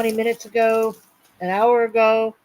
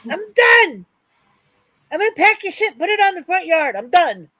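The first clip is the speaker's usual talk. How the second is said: much higher, loud fed-up rant; fed up, threatening to pack his stuff; exasperated; 5 semitones higher, 4 dB louder than usual. Real, not acted. Exasperated outburst at home chaos.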